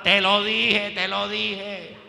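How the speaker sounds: mildly acted parental scolding; light mock reproach, drawn out at the end; parent's I-told-you-so. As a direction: chide a child, stretching the repeat. Parent chiding crying child, acted.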